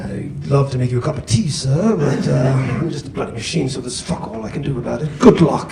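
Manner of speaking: lightly put-on British butler voice; a GPS voice, prim and polite, then crude; hint of a British butler GPS. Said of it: "this British butler voice".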